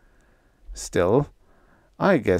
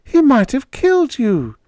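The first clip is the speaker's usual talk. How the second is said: much higher, louder anguished wail; 7 dB louder than usual. A narrator's reading. Panda wailing at Freddie in fear for him. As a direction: keep wailing, frightened. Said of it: "she wailed".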